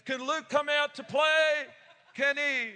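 loud, high call at the door; excited calling out. Retold or imitated. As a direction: call a friend out to play.

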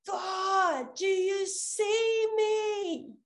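high, loud, drawn-out cry to the heavens; crying out to God. Retold or imitated. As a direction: call out slowly, almost singing, to an empty sky. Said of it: "Like that".

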